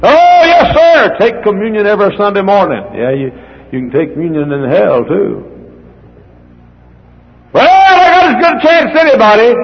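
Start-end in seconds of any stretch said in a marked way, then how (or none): 0.0-2.8 high loud eager assurance; mock churchgoer. Preacher mocks a churchgoer's easy answer, high eager voice. 7.5-9.5 high loud eager assurance; mock churchgoer. Preacher mocks a churchgoer's easy answer, high eager voice.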